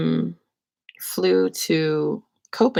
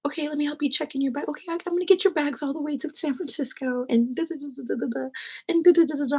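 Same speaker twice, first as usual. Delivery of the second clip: lightly voiced brisk agent, higher and quick; a hint of a busy, cheerful agent; imitating Fiona; 10 semitones higher, faster than usual. Voicing agent Fiona at check-in.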